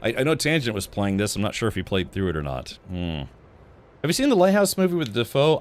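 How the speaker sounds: ordinary talk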